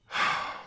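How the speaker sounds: open-mouthed relaxed sigh. Shown as a demonstration. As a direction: let the breath fall out in a sigh. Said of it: "just go".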